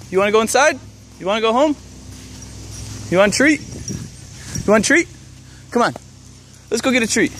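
calling a lost pet. Real, not acted. Coaxing a lost dog.

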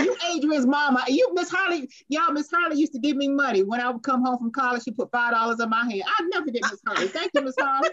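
high-pitched, heartfelt excitement; real excitement at recognizing her; excited gratitude. Real, not acted. Recognizes and thanks old benefactor.

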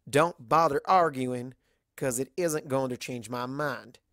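lightly voiced Southern farm mare, frank and friendly; level with her like a blunt country friend; a hint of a drawling Southern mare, teasing; Applejack voice. Applejack insisting.

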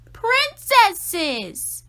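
a loud, indignant exclamation; loud exasperated cry. A narrator's reading. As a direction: shout it out, fed up and disgusted. Olivia exclaims in disgust.

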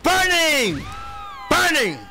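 excited shout. Real, not acted. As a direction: yell it like it's on fire. Hyping a combo in game.

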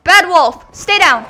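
loud, firm telling-off; playful loud reprimand; scolding a dog. Pretend. Scolding the wolf in game fight.

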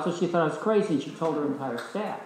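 usual speaking voice

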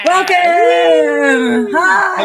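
high, loud, drawn-out cheer; whooping welcome. Real, not acted. Co-host greeting Ugetta as she comes in, whooping welcome. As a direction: shout a big hello to the arriving guest.

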